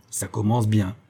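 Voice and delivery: ordinary delivery, nothing marked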